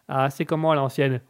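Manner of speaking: ordinary talk, nothing marked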